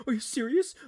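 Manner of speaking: high-pitched incredulous question; squeaky amazed rise; mock disbelief. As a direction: imitate him astonished, voice shooting up. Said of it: "He's like".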